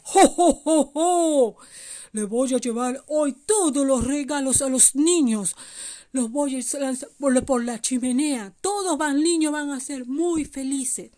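jo, jo, jo sonoro de Santa; voz actuada de un Santa contento; Santa Claus alegre. Acted. (Santa Claus bonachón y alegre)

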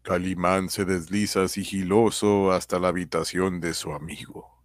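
dramatic serial narration; old radio serial announcer. A narrator's reading.

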